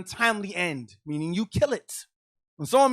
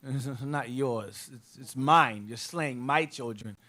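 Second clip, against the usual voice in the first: lower, slow voice of the Lord, grave; solemn divine rebuke, lowered and slowed; voicing God; 5 semitones lower, 4 dB quieter, slower than usual. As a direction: speak as God rebuking, slowly. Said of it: "The Lord said".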